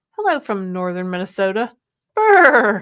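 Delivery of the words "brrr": cold shiver brr; a quick chattering brr. Pretend. Cold northern Minnesota greeting.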